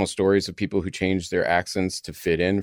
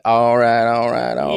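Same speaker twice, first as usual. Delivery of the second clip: vocal fry; 4 dB louder than usual. Shown as a demonstration. Host demonstrating powerful male speech, heavy vocal fry. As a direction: let the voice crackle into vocal fry. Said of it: "But vocal fry, I get".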